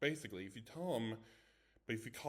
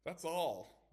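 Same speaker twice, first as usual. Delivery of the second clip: mocking; 8 semitones higher, 5 dB louder than usual. A narrator's reading. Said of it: "Arnold laughed".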